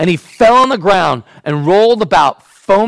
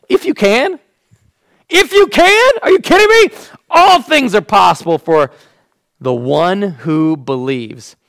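incredulous, much higher and louder; boiling over, are you kidding; irritated disbelief; 5 semitones higher, 5 dB louder than usual. Acted. Jesus incredulous at the father's doubt. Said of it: "it just kind of boils over".

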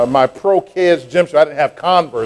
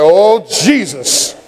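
slow dread; 4 semitones higher, much slower than usual. Retold or imitated. Dread at going back.

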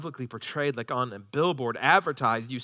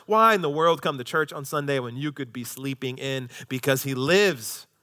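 ordinary talk, nothing marked

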